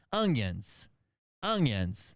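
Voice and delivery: onions said like youngins, ungyunz. Shown as a demonstration. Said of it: "onions, like youngins".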